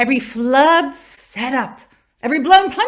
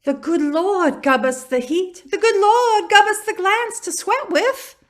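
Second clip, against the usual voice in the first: mock Southern accent; 6 semitones higher than usual. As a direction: mimic her botched Southern accent line. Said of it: "that whole accent thing".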